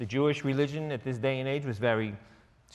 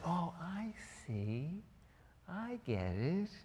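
mock realization; 5 semitones higher, 10 dB quieter, slower than usual. Mock reply of someone who understands.

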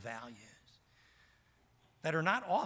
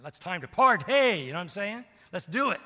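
loud, much higher, all fired up; hyped party call; 6 semitones higher, 11 dB louder than usual. Retold or imitated. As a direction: shout it like a young party animal. Young self hyped for party.

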